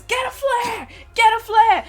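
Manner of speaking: loud, high Australian shout; shouted order in an Australian accent; Australian captain. Acted. Captain of sinking ship ordering crew, Australian shout. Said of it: "the captain had an Australian accent".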